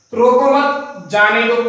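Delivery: slow रोको मत, short break, then जाने दो; group रोको मत together, pause, release जाने दो; pause after मत. Shown as a demonstration. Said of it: "इस कोमा के वाक्य में स्थान बदलने". (रोको मत, फिर रुककर धीरे से जाने दो)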